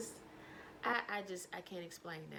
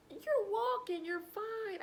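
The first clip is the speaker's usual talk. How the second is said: mock reassurance; 10 semitones higher, 4 dB louder, slower than usual. Reassuring angry friend.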